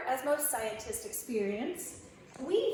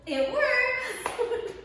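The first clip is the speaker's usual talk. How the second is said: laughing; 10 semitones higher than usual. Real, not acted. String phone test succeeds.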